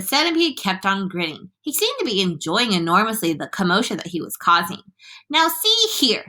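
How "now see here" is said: high commanding call; shout. A narrator's reading. Police chief addresses the centipede, shouting. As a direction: call up firmly in a raised pitch. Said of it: "shouted the chief of police".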